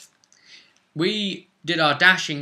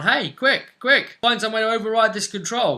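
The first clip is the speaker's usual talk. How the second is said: high, loud, urgent call; raised voice, acting out urgency; retold shout down; 10 semitones higher, 6 dB louder than usual. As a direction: imitate shouting an order to people below. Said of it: "So I shouted down".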